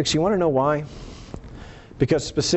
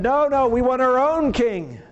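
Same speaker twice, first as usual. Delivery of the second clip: high-pitched, stubborn protest; insistent demand; 12 semitones higher than usual. Acted. Israelites rejecting God as their king, insistent demand.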